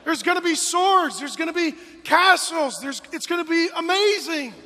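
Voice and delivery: high, loud hype; loud enthusiastic promise to a sulky toddler; excited selling. Retold or imitated. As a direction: build it up like a carnival barker. Dad selling trip.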